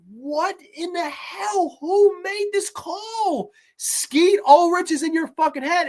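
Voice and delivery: high, slow, incredulous; high stunned disbelief; mock bewildered. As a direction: stretch out a baffled whine. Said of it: "I'm like".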